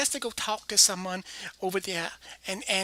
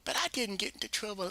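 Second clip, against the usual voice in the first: normal talking voice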